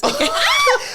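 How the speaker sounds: shouted high laugh; real shouted laugh; loud high laugh. Real, not acted. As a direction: let out a loud squealing laugh. Laughs at her own yes.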